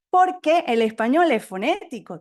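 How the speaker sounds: normal talking voice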